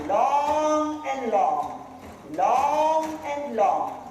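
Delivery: slow, stretched-out words; drawn out. Real, not acted. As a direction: draw each word out slowly. Instructor stretching the walk.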